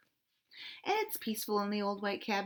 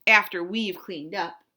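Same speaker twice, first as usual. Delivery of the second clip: loud, curt afterthought; stormed; 8 dB louder than usual. Acted. Cat storms at duck, loud finish. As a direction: spit the last bit out. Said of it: "stormed the cat".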